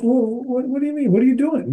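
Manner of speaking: high, incredulous questions; can't believe what you're seeing; baffled protest. Retold or imitated. At the registration table, asking the lady why his name is missing, baffled and high.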